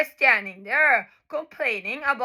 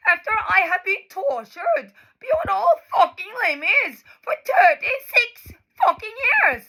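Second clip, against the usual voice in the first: higher, tearful shouting; crying shout; 4 semitones higher than usual. Real, not acted. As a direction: yell it through real tears. Rant breaks into tearful shouting.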